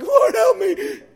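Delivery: high, loud, slow cry for help; panicked plea. Retold or imitated. Preacher acts a drowning person's cry, panicked high plea. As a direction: beg the Lord in sudden terror. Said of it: "we're like".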